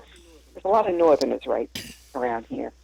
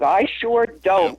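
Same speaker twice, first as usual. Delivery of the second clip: slow "I sure don't" with weight on "sure"; a mild Georgia emphasis, "sure" stressed in "I sure don't"; emphasized negative; slower than usual. Shown as a demonstration. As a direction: lean on "sure" in "I sure don't", drawn out slowly. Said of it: "everything in the negative is very emphasized".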